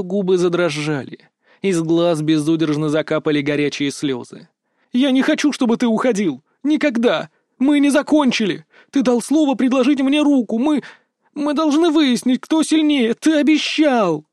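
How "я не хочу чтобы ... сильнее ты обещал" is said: high, loud, pleading outburst; desperate protest. A narrator's reading. Tearful Berta demands that her fiancé stay, desperate high cries.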